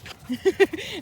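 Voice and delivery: high, loud laugh as the dog jumps up; sudden loud laugh; burst of laughter. Real, not acted. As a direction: laugh out loud, caught off guard.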